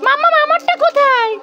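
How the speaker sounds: put-on high, eager little child asking mummy. Mother voicing her child asking mummy for a present, high childish voice. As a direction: pitch up into a small kid's excited question.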